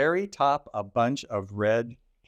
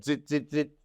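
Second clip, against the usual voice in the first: monkey chatter; 3 semitones higher than usual. Acted.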